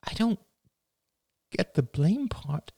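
high, halting, upset voice; quivering. A narrator's reading. Daughter puzzled at her father's blame, quivering. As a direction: say it on the edge of tears. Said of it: "Rachel's lips were quivering".

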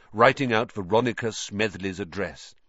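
ordinary delivery, nothing marked

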